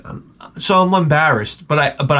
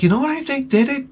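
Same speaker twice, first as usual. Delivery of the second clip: high, fast eager theory; eager realization; 13 semitones higher, faster than usual. Retold or imitated. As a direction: blurt out the new idea. Realizing the cause.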